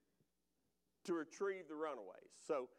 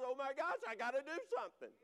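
mock alarm; 7 semitones higher, faster than usual. Mock realisation at mirror.

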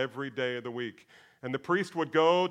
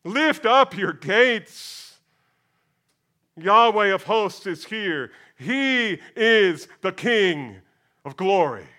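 loud, very slow, higher proclamation; emphatic declaration; 5 semitones higher, 7 dB louder, much slower than usual. Acted. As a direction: command the gates to open. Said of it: "declares emphatically".

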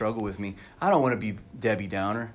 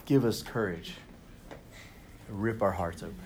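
soft, hushed prayer voice; closing prayer; 2 semitones lower, 8 dB quieter than usual. Acted. Pastor praying over the congregation, soft and hushed. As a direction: lead them into quiet prayer.

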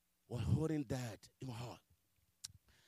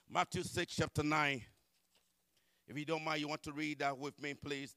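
ordinary talk, nothing marked

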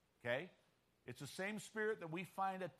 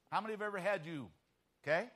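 ordinary talk

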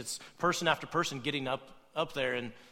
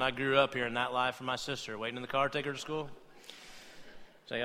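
ordinary talk, nothing marked

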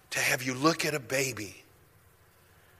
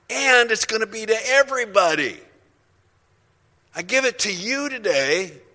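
shouting, higher, slow herald; angel's loud proclamation; 8 semitones higher, 8 dB louder than usual. Acted. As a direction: call out the good news to shepherds. Angel announcing to shepherds.

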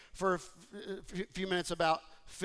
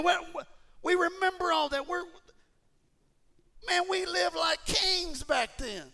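mocking the grumblers' rosy memory; mock boasting complainers; 10 semitones higher, 3 dB louder than usual. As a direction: sneer as if Egypt was luxury.

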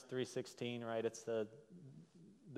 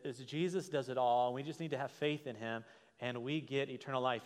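normal talking voice

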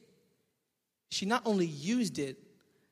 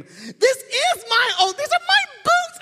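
very high, loud, slow mock wife voice; imitating wife; 11 dB louder, slower than usual. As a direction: drawl it out as his amused wife. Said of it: "I know it verbatim".

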